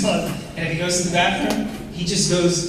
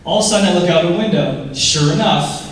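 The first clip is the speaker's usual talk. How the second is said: normal talking voice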